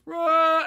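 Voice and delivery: high, loud wordless roar; mock yell. Imitating an actor who yells in every scene, mock yell. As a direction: let out a sudden high-pitched "rah". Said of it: "He yells".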